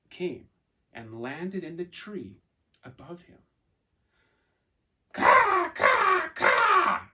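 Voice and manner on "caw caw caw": high, loud cawing cackle; laughing raven caw. A narrator's reading. Raven laughing at the foolish humans, loud high caws. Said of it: "laughed a raven".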